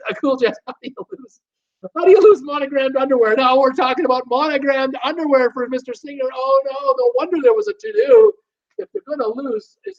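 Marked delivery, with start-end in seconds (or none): 0.1-8.3 high laughing disbelief; laughing incredulous. Real, not acted. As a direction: giggle through the question. Amused incredulous reading of chat.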